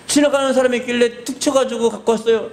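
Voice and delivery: put-on high kid explaining; child's voice. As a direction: tell it like nothing happened.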